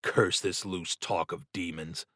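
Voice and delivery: ordinary delivery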